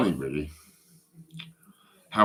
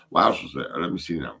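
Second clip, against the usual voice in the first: ordinary delivery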